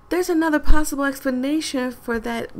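ordinary delivery

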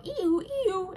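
high-pitched two-tone alarm imitation; game alarm noise. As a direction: imitate a game's alarm going off. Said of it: "interrupted by the game going".

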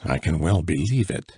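plain reading voice

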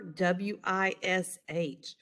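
ordinary delivery

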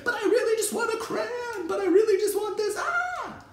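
very high mock child whine; hammed-up pleading tantrum; kid in hot brain. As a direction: squeal it like a kid melting down. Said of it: "When the kid's like".

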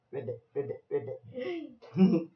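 put-on playful ribbit noises for a child; little frog calls; frog imitation. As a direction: croak like a storybook frog.

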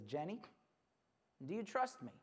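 soft, low bedtime question; gentle dad. Acted. Dad asking his daughter for trust, gentle and hushed. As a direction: ask softly, as a father at bedside.

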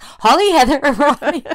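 high, loud imitation of her greeting; imitated greeting, breaking into laughter; sister's excited greeting. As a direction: call out the names, laughing at the end. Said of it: "So she was like".

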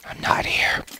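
breathy whispered hiding. Real, not acted. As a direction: whisper so nothing hears you. Hiding in game until day.

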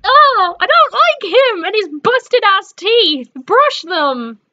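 high loud disgust; outraged shout; startled rant. Real, not acted. Startled then insulting.

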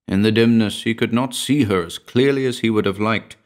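plain reading voice

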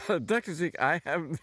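trying to talk through giggles; words breaking into laughter; laughing. Real, not acted. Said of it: "I can't stop laughing".

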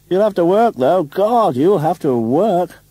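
high, loud, emphatic stage oratory; theatrical shout. A narrator's reading. Theatrical man warning the actor of work ahead, declaimed loudly. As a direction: declaim it with great flourish. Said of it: "part of the theatrical pose".

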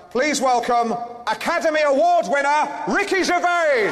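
rising, drawn-out showman announcement; big announcer intro. Real, not acted. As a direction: announce the star like a game show host. Host announcing star act.

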